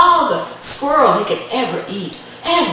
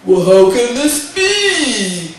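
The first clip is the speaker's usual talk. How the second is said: loud, slow giant's question; puzzled giant; 6 dB louder, slower than usual. Acted. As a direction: boom it out with drawn-out words. Giant Wendigo questions the boy.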